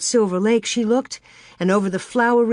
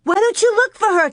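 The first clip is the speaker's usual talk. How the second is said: high, loud accusing cry; frantic little sister; 13 semitones higher, 5 dB louder, faster than usual. A narrator's reading. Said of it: "Carrie cried".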